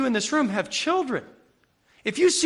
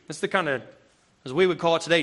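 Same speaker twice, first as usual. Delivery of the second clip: ordinary delivery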